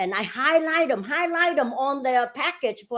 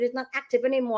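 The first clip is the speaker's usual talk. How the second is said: ordinary talk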